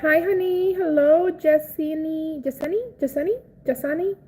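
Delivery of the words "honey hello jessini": ordinary delivery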